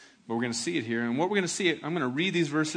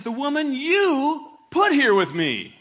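blame-shifting Adam; 10 semitones higher, 9 dB louder than usual. Acted. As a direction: play Adam passing the buck, half laughing. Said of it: "He says".

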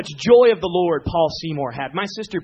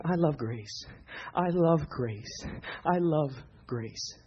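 mock tearful; 2 semitones lower, 7 dB quieter, much slower than usual. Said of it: "And he was crying".

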